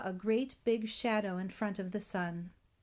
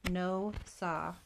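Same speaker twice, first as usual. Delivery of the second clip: ordinary delivery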